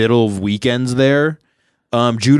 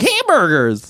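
put-on high squeaky kid impersonation; imitated kid's high, squeaky outburst; 8 semitones higher, slower than usual. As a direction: pipe it up high, like the kid blurting out. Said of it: "like this high squeaky voice".

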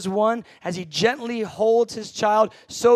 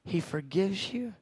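preacher's call and response; 3 semitones lower than usual. Acted. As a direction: lead the congregation through the psalm.

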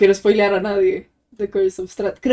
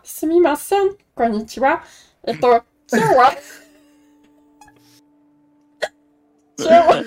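goblin voice; 6 semitones higher than usual. Acted. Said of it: "Esa voz de Goblin".

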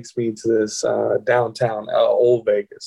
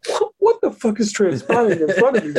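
high, stammering mock shock; comic incredulity with laughter in it; bewildered disbelief; 9 semitones higher than usual. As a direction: replay a stunned inner voice, pitched up. Retelling his bewildered inner reaction.

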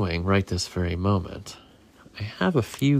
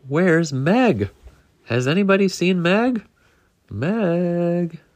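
calling out; 6 semitones higher, 4 dB louder, slower than usual. Acted. Shell calls for missing egg.

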